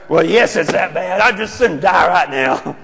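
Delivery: petulant, loud insistence; mock upset reply. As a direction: dig in that it really is that bad. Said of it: "And you know what they are?".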